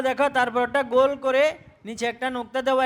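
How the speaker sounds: ordinary talk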